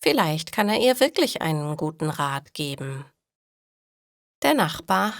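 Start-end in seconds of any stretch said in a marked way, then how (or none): none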